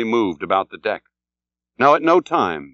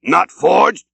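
much higher, louder calls; eager cry; 6 semitones higher, 4 dB louder than usual. A narrator's reading. Ahab crying to the other captain, loud and eager. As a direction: cry out across the water. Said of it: "cried Ahab".

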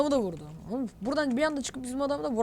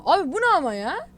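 higher, louder indignant protest; real annoyed outburst; 5 semitones higher, 6 dB louder than usual. Real, not acted. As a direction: protest the unfair kill. Ranting at the kill.

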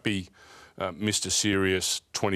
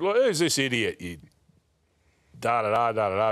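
angry outburst; 3 semitones higher, 3 dB louder than usual. Retold or imitated. Abusing a supposed prank caller.

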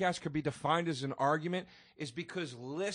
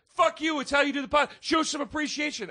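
higher, mock-indignant snap; mock angry retort; 9 semitones higher than usual. Mocking a co-host's angry comeback. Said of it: "And then you went".

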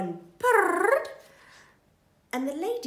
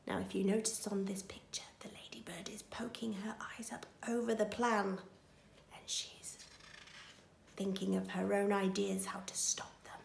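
whispering; 9 dB quieter, faster than usual. Real, not acted. Aside to listeners about picture.

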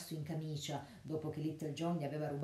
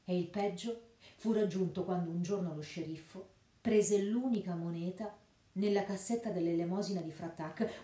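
ordinary delivery, nothing marked

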